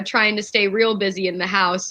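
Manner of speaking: ordinary talk, nothing marked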